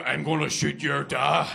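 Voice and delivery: slow, loud threat; menacing Northern Irish heavy. Acted. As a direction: threaten slowly like an armed thug. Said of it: "We're the other guys".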